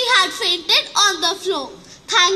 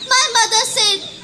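plain reading voice

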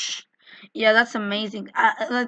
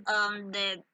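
much softer, dying away; fading mock death; 4 semitones lower, 11 dB quieter than usual. Player fades out after being killed in game. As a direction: let the line expire.

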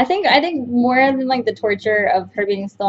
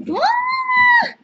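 startled shriek. Real, not acted. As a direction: yelp as you fall. Startled cry in game.

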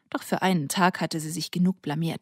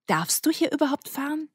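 much higher, doubtful challenge; incredulous question; 8 semitones higher than usual. Acted. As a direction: question him in disbelief. Milla doubts his licence.

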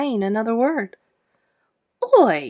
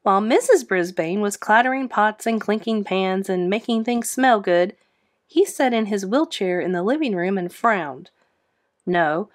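plain reading voice

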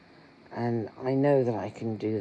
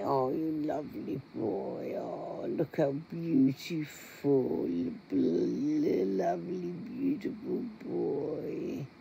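higher, slow doting coo; pet talk; 5 semitones higher, slower than usual. Real, not acted. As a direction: fuss over the cat tenderly. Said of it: "I tend to say".

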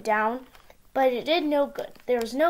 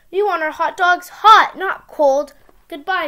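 loud complaining; 4 semitones higher, 8 dB louder than usual. Acted. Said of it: "they said".

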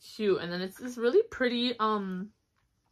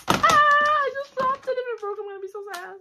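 much higher and louder startled yelp; startled cry; 6 dB louder than usual. Real, not acted. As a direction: yelp as it slips from your hands. Dropped the palette.

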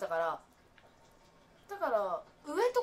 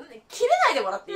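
laughing; 6 semitones higher, 12 dB louder, faster than usual. Real, not acted. Laughing complaint at friend.

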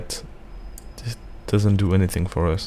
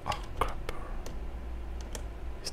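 whispering; 17 dB quieter than usual. Real, not acted. Stealth game, enemies near.

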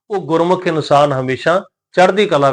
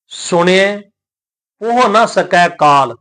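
slow, measured recitation of sacred verse; scripture recitation; 3 semitones higher, slower than usual. Shown as a demonstration. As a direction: recite each word drawn out, with pauses.

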